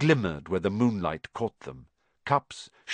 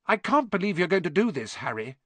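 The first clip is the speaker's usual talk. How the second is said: quick, higher-pitched scolding, dropping on the name; disapproving; 6 semitones higher, faster than usual. A narrator's reading. Girl confronting friend sneaking out at night, disapproving. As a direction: scold him in a high, hurried voice. Hermione confronts boys in narrated story.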